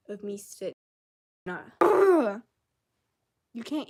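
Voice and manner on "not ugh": frustrated groan. Real, not acted. Frustrated outburst mid-sentence.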